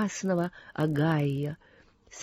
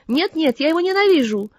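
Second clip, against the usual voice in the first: raised, louder outburst of hate; vehement, higher-pitched refusal; angry protest; 6 semitones higher, 5 dB louder than usual. A narrator's reading. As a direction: protest fiercely, pitch jumping up. Ann's inner angry protest.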